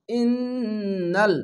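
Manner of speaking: slow, drawn-out melodic chant; Quran recitation. Shown as a demonstration. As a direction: intone it like tajweed recitation. Tajweed recitation of Quran word. (ধীর সুরেলা কুরআন তিলাওয়াত)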